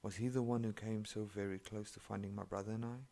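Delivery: ordinary delivery, nothing marked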